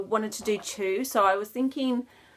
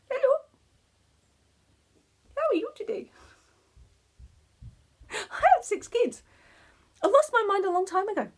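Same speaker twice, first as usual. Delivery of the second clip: much higher, squeaky toy voice with a whispered start; cute monkey toy voice; 13 semitones higher, faster than usual. Acted. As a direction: give the little knitted monkey a squeaky, cheeky voice.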